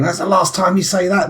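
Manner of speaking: high-pitched imitation of angry kids; kids scolding Dad. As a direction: voice your children laying down the law. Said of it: "my kids going".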